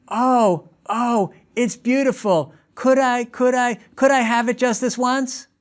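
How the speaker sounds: put-on high, pleading little kid voice; sweet-talking child wheedling a parent for a lollipop; begging child. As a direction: plead like a five-year-old eyeing a lollipop.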